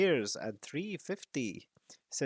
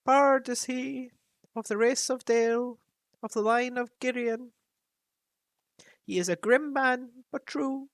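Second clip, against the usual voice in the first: higher, grave raven voice; counselling raven; 13 semitones higher, 3 dB louder than usual. Acted. Raven Roak counsels Thorin about coming danger. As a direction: warn him like an ancient advisor.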